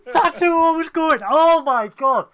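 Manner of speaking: high, loud outburst of recognition; excited disbelief. Real, not acted. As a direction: blurt it out, thrilled at the coincidence. Amazed at the same reference.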